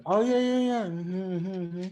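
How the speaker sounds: high, hurried-off agreement; mock eager agreement, falling away in volume; yessing someone. Yessing someone to get rid of the convo, mock agreeable. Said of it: "I've yesed people to death".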